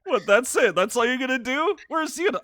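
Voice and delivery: high, loud acted disbelief; hammed-up indignant questioning; mock incredulous. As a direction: play it affronted, pitched right up. Acted quarrel, incredulous game hero.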